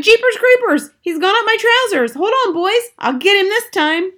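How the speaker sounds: very high, loud panicky yell; shouted. A narrator's reading. Cook with mouse up his trousers, shouted. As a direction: holler to the boys in alarm. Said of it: "the cook shouted".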